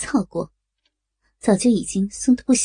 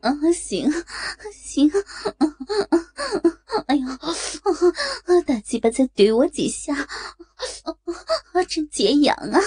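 coy protest broken by moans; higher-lifted words between gasps; moaning, coy woman; 6 semitones higher than usual. A narrator's reading. Character moans while agreeing to call. (娇嗔地喘息呻吟)

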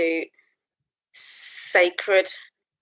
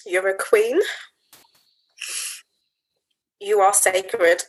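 solemn affirmation. Real, not acted. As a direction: speak each line as a sacred promise. Slow solemn affirmations, long pauses.

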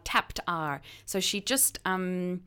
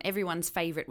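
ordinary talk, nothing marked

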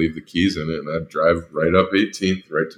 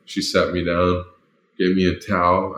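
normal talking voice

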